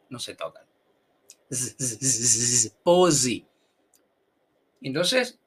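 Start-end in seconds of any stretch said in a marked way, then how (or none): 1.5-3.5 repeated "z" buzz released into "pose" as PO-zi. Shown as a demonstration. Teacher showing the close-teeth Z in "pose". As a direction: buzz "z" in bursts, then land loudly on "pose". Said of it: "Los dientes se quedan cerquita".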